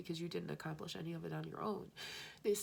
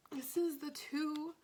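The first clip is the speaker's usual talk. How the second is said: ordinary talk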